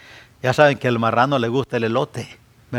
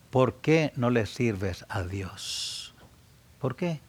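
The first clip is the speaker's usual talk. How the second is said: drawn out, each word weighed; slow it right down to press the point; slow pleading repeat; 2 semitones lower, 11 dB quieter, slower than usual. Shown as a demonstration. Preacher pressing listeners with a slow repeated question. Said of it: "repeats the question slower".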